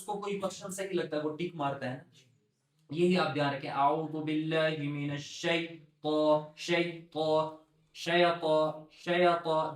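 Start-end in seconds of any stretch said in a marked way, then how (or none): 3.7-9.7 شیطان stretched slowly, syllable by syllable; drawn-out shaitan. Shown as a demonstration. Teacher modelling pronunciation for the students. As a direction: hold each part of شیطان long. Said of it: "دیکھو فرق ہے اس اور اس symbol میں".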